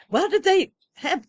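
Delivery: high, loud put-on foreign accent; imitating an Eastern European trucker's accent. Trucker at the coffee pots, mimicked in his foreign accent. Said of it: "in the accent".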